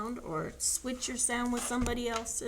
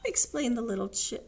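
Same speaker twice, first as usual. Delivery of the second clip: plain reading voice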